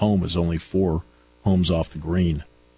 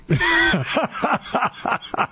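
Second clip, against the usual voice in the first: real burst of laughter, pitched high. Real, not acted. Host laughing at co-host's banter.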